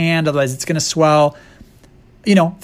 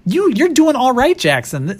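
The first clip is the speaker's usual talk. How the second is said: high teasing voice; mock quote; 8 semitones higher than usual. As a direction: pitch it up, mocking. Mock quote of [name].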